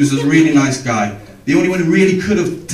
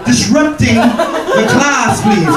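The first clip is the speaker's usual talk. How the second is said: high, slow, hammed-up stern teacher; a strict teacher telling off a pupil, mock stern; 11 semitones higher, slower than usual. Teacher scolding class clown.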